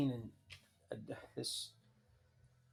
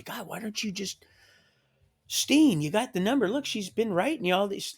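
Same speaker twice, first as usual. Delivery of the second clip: urging friend; 8 semitones higher, 8 dB louder than usual. Retold or imitated. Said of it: "'cause I've had people say".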